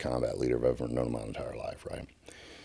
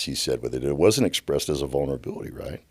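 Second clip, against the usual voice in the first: ordinary delivery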